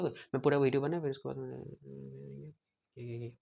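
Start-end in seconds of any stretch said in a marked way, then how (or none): 1.0-3.3 fading voice. Shown as a demonstration. Teacher demonstrating myasthenic fading speech, voice dies away. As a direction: voice fades away toward the end. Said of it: "मैं ऐसे ऐसे बोल रहा था".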